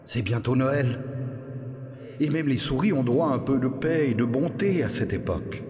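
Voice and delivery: Thibaut doux et persuasif, un peu plus aigu; Thibaut plaide. Acted. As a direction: convaincre Robert avec bonté. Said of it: "intervint Thibaut". (Thibaut supplie Robert d'épargner la souris, doucement)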